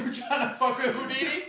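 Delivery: high, loud, laughing tough-guy challenge; tough guy taunt. Retold or imitated. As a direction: taunt the crowd, grinning. Hyping up tough Houdini.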